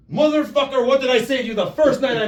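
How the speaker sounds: high, loud retold outburst; mock scolding. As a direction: imitate an exasperated telling-off, loud and high. Retold mock scolding after the match.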